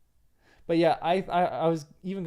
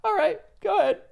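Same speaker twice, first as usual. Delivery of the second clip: much higher, louder goofy guard with a laugh; silly high voice; 8 dB louder than usual. Retold or imitated. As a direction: play the guard as an eager goof. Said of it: "And Hama's like".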